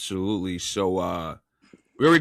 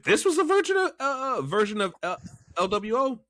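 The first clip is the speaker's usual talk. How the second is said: excited; 11 semitones higher than usual. Real, not acted. Excited fantasy of luchador team.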